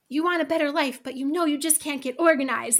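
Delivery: mildly hammed-up hard sell; forceful sales pitch. Shown as a demonstration. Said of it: "It's, like, forceful".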